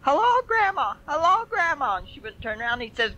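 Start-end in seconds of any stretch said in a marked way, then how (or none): 0.0-2.0 put-on high, loud little boy calling out; child hollering. Little boy in the yard calls to a passing lady, high and loud. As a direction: shout across the yard like an excited small boy. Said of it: "he hollered".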